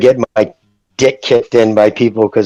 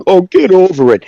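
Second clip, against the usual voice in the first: mocking fans; 7 semitones higher, 5 dB louder than usual. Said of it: "his fans are like".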